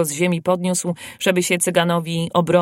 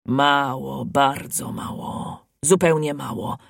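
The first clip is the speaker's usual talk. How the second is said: low, slow, muffled grumble; grumbling; 4 semitones lower, slower than usual. A narrator's reading. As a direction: grumble to yourself over scant food. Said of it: "burczał". (niskie burczenie)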